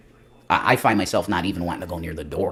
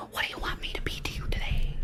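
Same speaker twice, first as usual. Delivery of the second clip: softer teasing whisper; 11 dB quieter than usual. Pretend. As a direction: breathe it close to the mic. Teasing aside to viewers.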